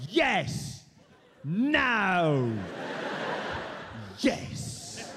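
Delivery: clipped one-word verdicts from [name], the last one dropping to a whisper. Retold or imitated. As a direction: lord it over the room, one word at a time. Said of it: "[name] talked like this".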